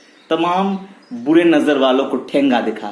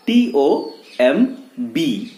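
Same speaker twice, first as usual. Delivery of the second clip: usual speaking voice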